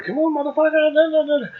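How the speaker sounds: high-pitched mock rant at the crowd; mock furious rock star. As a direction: ham up a fuming frontman chewing out the audience. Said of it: "he's fuming".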